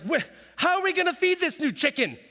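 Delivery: hammed-up panic about chicken feed; freaking out. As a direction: spiral into mock panic over feeding. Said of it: "I just freak out about things".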